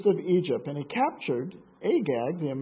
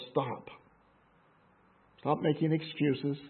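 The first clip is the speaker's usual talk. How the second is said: stern quiet rebuke; 2 semitones lower, 6 dB quieter than usual. A narrator's reading. Samuel rebuking Saul.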